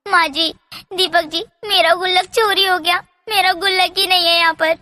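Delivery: wife calling to her family that her piggy bank is gone, alarmed; distressed crying out. Acted. घबराई हुई ऊँची पुकार. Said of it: "Poonam के रोने की आवाज".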